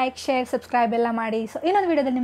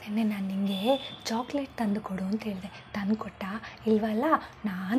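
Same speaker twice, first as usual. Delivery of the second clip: soft, lower, whispered little brother; whiny child; 6 semitones lower, 10 dB quieter than usual. Acted. Little brother complaining about missing chocolate, softly whining. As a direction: sulk like a small kid.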